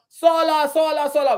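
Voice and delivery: high, loud senseless hollering; Lancelot yelling. Acted. Lancelot the idiot messenger, just yelling out. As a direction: yell it out like a noisy nuisance. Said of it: "So he just yells out".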